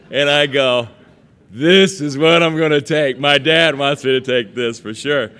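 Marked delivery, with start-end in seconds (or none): 1.5-5.3 put-on much louder, higher, thrilled young student; student telling himself his choice, loud and excited. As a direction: blurt it out with eager delight. Young [name]'s excited decision.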